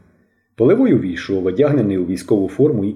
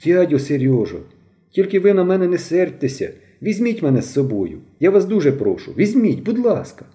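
put-on higher, begging boy's voice; pleading boy; 6 semitones higher than usual. A narrator's reading. Mishko begs Polivoi to take him along, high pleading. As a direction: plead with all your heart to be taken. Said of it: "Мишків голос затремтів".

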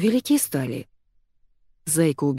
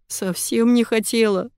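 high, queasy moan; weakly moaned, higher-pitched reply; 5 semitones higher than usual. A narrator's reading. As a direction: groan it through nausea. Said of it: "простонала я".

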